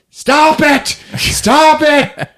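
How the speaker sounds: high mock-desperate yell; hammed-up frantic command; struggling shout. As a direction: shout it while wrestling someone. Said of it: "He starts wrestling her".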